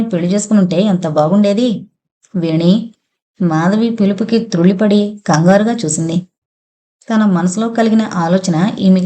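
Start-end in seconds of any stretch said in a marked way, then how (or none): none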